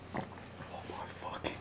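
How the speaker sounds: slow whisper through the burning; pained whisper. Real, not acted. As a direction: breathe it out in pain. Still burning mouth.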